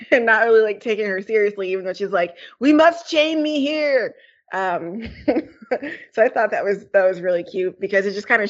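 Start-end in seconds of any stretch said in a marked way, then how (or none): 2.6-4.2 high, slow, grand proclamation; dramatic. Retold or imitated. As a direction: draw it out like a melodrama heroine. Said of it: "dramatic, like".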